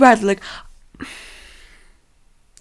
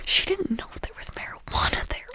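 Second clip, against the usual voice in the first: whispered aside; 9 semitones higher, 11 dB quieter than usual. Real, not acted. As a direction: confide it in a whisper. Whispered aside about mom.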